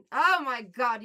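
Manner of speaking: loud surprised outburst; loud exclamation. Real, not acted. Streamer shocked by a viewer's gift. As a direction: blurt it out in shock.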